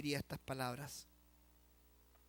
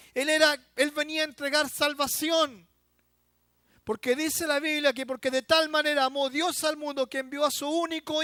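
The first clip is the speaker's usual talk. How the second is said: full force preaching shout, higher and louder; predicación a gritos; 10 semitones higher, 14 dB louder than usual. Acted. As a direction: proclaim it to the crowd. Predicador a plena voz. (predicación a todo pulmón)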